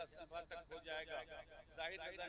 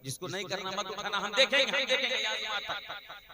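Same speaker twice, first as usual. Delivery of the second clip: much louder, pitch climbing, full preaching force; fiery challenge; 5 semitones higher, 15 dB louder than usual. Real, not acted. Preacher loudly daring the crowd to raise their hands.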